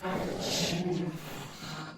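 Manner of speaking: soft otherworldly noise in place of a name; inhuman, drawn-out name in dragon language; dragon-tongue name. Acted. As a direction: utter a dragon's unpronounceable name. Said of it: "pronounce the name of my little brother".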